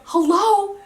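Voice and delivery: high, loud mock outburst; incredulous retelling. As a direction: exclaim it as if he missed the huge news. Retold disbelief at his calm reaction.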